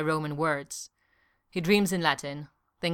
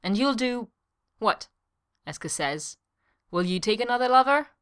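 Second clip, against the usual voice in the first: raised, higher pressing questions; angry; 6 semitones higher, 4 dB louder than usual. A narrator's reading. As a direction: challenge him heatedly.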